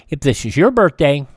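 normal talking voice

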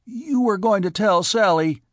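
high, loud desperate accusation. A narrator's reading. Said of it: "Mike said desperately".

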